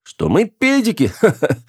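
very high, incredulous, breaking into laughter; hysterical laugh. A narrator's reading. Officer mocks what the sailor will think, hysterically laughing. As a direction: squeal the question, then cackle hysterically. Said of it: "истерично хохотнул Сюр".

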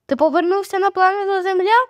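high-pitched teasing exclamation; exclaimed question. A narrator's reading. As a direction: exclaim it high, rousing a daydreamer. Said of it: "вигукнула Джулія".

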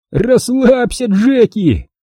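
high, teasing call without laughter; laughing taunt. A narrator's reading. Wilson teases Jack in the air, gleeful taunt. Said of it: "захохотал он".

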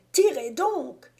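high, drawn-out urging shout; urgent cry. A narrator's reading. Moquet urging his companion to fire, crying out. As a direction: plead loudly for the shot. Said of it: "cria-t-il".

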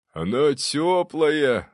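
high, drawn-out delight; delighted ghostly ancestor; joyful. A narrator's reading. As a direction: announce it happily, stretching the words. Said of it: "радостно сообщил нам".

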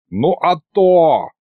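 slow, high, pompous assent; swaggering drawn-out confirmation; self-important. A narrator's reading. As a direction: stretch it out importantly. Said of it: "важно кивнул он".

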